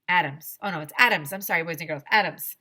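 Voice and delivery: plain reading voice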